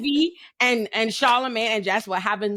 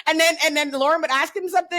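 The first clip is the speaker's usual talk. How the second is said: usual speaking voice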